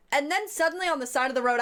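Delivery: ordinary talk, nothing marked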